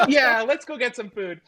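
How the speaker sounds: high, loud, chuckling agreement; mock laughing through tears. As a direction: act cheered up, laughing as you agree. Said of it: "they both laugh".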